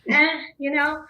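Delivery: casual, unbothered "eh"; easygoing shrug. Acted. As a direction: shrug it off lightly. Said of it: "more calm and more level-headed".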